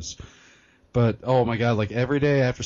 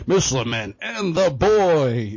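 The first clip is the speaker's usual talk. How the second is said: slow, louder morning-show announcer; radio duo intro; 6 semitones higher, 5 dB louder, slower than usual. Pretend. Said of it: "Morning Rush radio duos".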